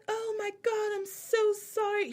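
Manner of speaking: high-pitched put-on mixed accent; Dorit's fake mixed accent. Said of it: "she knows she tries to do, does fake mixed accent".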